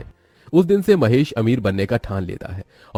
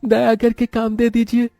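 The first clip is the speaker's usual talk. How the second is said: put-on very high-pitched boy's voice, begging; humble, high-voiced entreaty; pleading poor boy; 12 semitones higher than usual. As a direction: plead for work in a high little voice.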